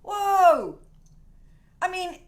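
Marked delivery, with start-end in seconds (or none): 0.0-0.8 surprised whoa. Real, not acted. Reacting to the big cat.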